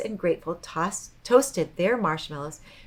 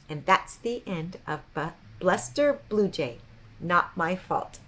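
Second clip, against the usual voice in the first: usual speaking voice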